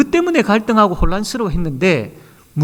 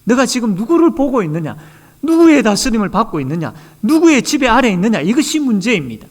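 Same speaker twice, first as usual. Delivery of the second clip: fervent preaching; 6 semitones higher than usual. Acted. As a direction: press the congregation with rising urgency. Preaching climax, rising intensity.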